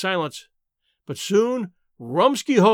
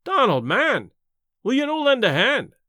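rising, higher sobbing plea; 6 semitones higher than usual. A narrator's reading. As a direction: beg through sobs, pitch climbing. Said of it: "he sobbed again".

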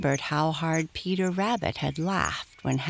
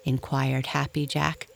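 usual speaking voice

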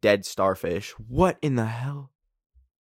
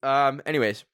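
ordinary delivery

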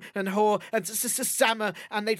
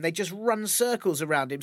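plain reading voice

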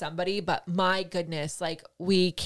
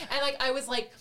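ordinary delivery

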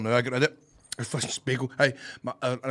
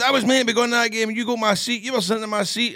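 raised voice, indignant retelling; mock indignant complaint; 8 semitones higher, 5 dB louder than usual. Podcast guest retells the seat grievance, mock indignant. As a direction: act out an aggrieved man griping.

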